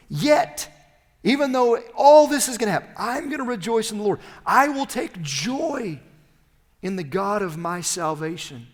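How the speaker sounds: loud, soaring joy, slowing on take joy; exultant preaching. Acted. Preacher voicing Habakkuk's resolve, loud and exultant. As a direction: proclaim it with triumph.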